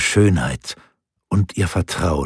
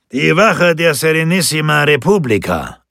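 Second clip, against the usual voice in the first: loud, high-pitched shout; ringing official call; 10 semitones higher, 7 dB louder than usual. A narrator's reading. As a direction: announce yourself loudly to someone far off. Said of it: "rief er".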